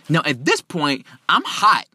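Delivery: usual speaking voice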